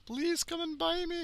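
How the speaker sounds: a put-on, badly done Scottish accent. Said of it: "that stupid Scottish voice".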